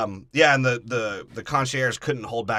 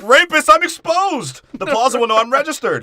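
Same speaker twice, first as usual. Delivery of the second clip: mock panic; 12 semitones higher, 9 dB louder than usual. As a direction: shriek it out in hammed-up panic. Concierge panics in riff.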